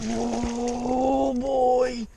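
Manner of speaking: loud startled whoa; excited shout. Real, not acted. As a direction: yell it as the fish hits. Fish rips rod away.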